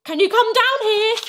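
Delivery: high, loud summons; alarmed shout. A narrator's reading. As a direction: call urgently up the stairs. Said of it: "she shouted".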